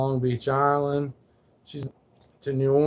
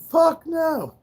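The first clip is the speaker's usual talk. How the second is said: high, loud mock snap of refusal; a quick, scornful no, much higher and louder; sharp retort; 5 dB louder than usual. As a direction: fire it back, way up high. Retorting to men about his dog.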